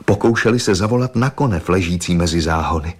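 usual speaking voice

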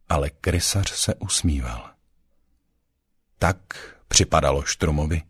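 ordinary delivery

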